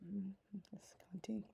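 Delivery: hoarse, breathy whispered name; losing her voice, whisper it; raspy whisper. Real, not acted. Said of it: "I'm losing my voice".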